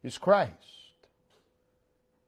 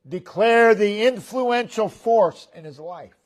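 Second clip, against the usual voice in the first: ordinary delivery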